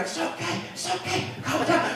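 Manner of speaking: soft, hushed soothing to a horse; calm the horse down gently; soothing rider. Acted. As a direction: rider hushing a spooked horse. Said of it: "the rider is going".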